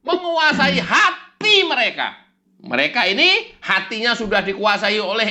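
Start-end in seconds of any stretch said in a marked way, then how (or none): 0.0-2.1 high, slow, loud sermon; mock preacher shout. Mock preacher declares how the devil rules hearts. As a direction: thunder it like a preacher.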